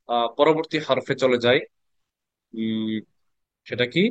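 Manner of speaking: ordinary talk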